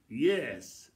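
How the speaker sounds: ordinary talk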